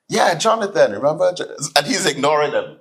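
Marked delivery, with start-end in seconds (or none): 0.1-1.3 higher, sly prompting; friend backing him up. Retold or imitated. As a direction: nudge the lie along knowingly. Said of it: "his friend says".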